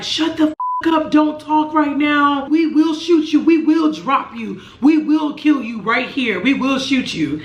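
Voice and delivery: a sharp replay of a shouted police order; imitating cop's yell. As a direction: retell the officers screaming threats at you. Retelling officers' threats at gunpoint.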